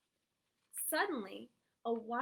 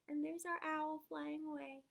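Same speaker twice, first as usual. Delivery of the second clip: usual speaking voice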